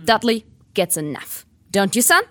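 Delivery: jovial gruff father; gruff uncle voice. Acted. Uncle Vernon to son.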